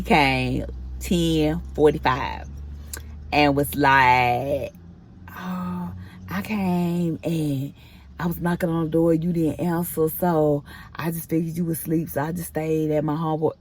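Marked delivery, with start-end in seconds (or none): 5.3-13.5 lightly mocked mumbly alibi; imitating boyfriend's excuse. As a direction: drag out a sheepish excuse quietly, mimicking him. Retelling boyfriend's feeble excuse.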